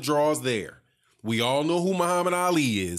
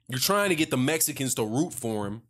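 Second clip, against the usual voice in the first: ordinary talk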